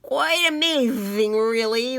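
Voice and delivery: slurred wet talk round a stuck-out tongue; slobbering. Acted. Wally, tongue on the bug, slobbers back at Willie. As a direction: drool through the words. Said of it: "Wally slobbered".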